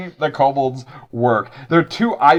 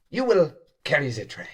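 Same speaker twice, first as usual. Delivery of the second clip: a hint of a stern chef, softer; head chef kobold; 5 dB quieter than usual. Head chef kobold giving the servant his task, lightly stern. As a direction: lay down the order quietly.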